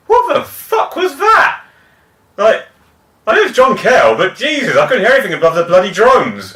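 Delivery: high-pitched, incredulous outburst; exasperated disbelief. Retold or imitated. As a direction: act out a baffled, exasperated concert-goer. Acting out baffled concert-goers afterwards.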